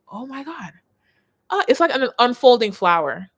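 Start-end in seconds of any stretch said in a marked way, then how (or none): 0.1-0.7 much softer, breathless wonder; as if stunned by something beautiful; hushed awe. Pretend. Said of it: "you're gonna be like".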